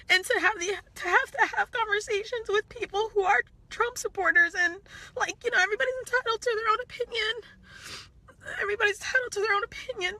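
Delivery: high, tearful voice; crying. Real, not acted. Talking about facing Trump supporters, crying. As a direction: push the words out while really crying. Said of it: "I'm really sorry that I'm crying".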